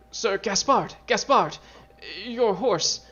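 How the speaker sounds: high, urgent cry of a frightened acolyte; panicked acolyte. Acted. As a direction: burst in calling out in alarm. Acolyte rushes in, alarmed.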